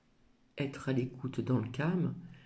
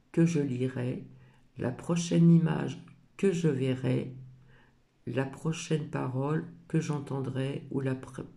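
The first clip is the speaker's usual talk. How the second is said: reads the oracle card's message aloud, a little louder and measured; reading a card; 2 semitones higher, 5 dB louder than usual. Acted. Reading her oracle card's message aloud to viewers, gently solemn. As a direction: read the card text as an affirmation.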